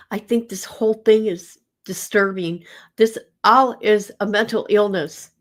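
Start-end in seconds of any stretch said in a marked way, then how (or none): none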